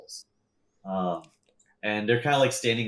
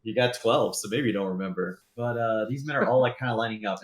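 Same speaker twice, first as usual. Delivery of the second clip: ordinary talk, nothing marked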